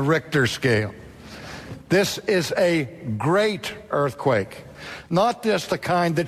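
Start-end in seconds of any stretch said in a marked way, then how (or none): 1.9-4.6 slow, with "great" drawn out and leaned on; preacher stretching out "great" so the congregation notices; stress on great. Shown as a demonstration. Preacher pressing on "great" for his congregation, slowly. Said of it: "Notice this".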